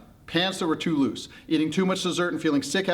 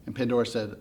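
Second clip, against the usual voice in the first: ordinary delivery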